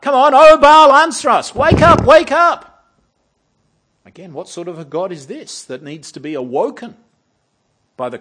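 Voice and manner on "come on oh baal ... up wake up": very high, very loud pleading yell; a desperate wake-up cry; frantic shout to wake Baal. Pretend. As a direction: scream to rouse a sleeping god. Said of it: "you've got to wake him up".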